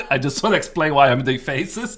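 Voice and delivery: giggling through the words; laughing. Real, not acted. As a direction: laugh while you apologise. Explaining her sleepy faces, laughing.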